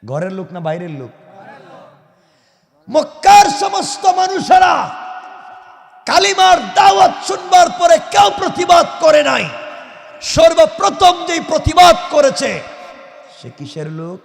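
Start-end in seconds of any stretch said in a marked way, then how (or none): 2.9-12.6 high, loud sermon delivery; full-force preaching. Real, not acted. Preacher telling the crowd who first opposed the call, full-force preaching. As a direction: preach it out in a raised, shouting voice.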